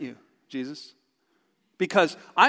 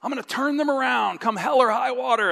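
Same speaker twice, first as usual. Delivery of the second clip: determined parent; 6 semitones higher than usual. Retold or imitated. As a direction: vow it through clenched resolve.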